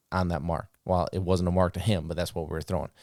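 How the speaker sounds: ordinary talk, nothing marked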